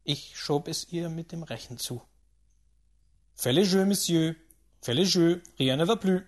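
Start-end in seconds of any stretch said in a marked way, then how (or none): 3.3-6.2 loud French table call; croupier's call. A narrator's reading. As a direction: call out for bets, measured and firm. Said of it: "rief der Croupier zum Setzen auffordernd".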